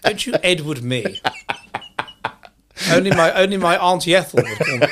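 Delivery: laughing through a mock rebuke; amused mock-indignant reply; laughing protest. Real, not acted. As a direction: push back while laughing. Laughing back at the teasing.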